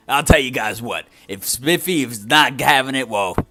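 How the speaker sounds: hammed-up sales pitch; salesman pitch. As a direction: sell it like a cheesy infomercial. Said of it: "as Scruffy was trying to say before, trying to con all you guys".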